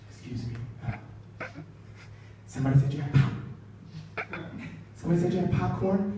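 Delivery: soft, hesitant, halting question; timid request. Acted. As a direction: approach a famous stranger meekly, stopping and restarting. Timidly approaching LeBron in theater.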